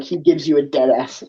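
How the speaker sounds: ordinary delivery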